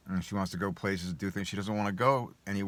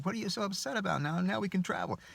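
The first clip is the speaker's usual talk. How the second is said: much higher, mock wife's voice; puzzled wife; 12 semitones higher than usual. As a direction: play the baffled wife, pitched way up. Wife answers upset husband.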